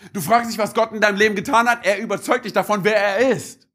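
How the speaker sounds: ordinary delivery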